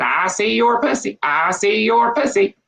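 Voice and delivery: a cheeky parrot taunting, drawn out. Acted. Parrot taunting the old woman in a put-on voice.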